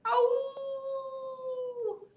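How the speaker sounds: high bird cry. Acted. George the bird cries out, high. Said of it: "George cried".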